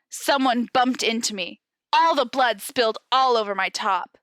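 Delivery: usual speaking voice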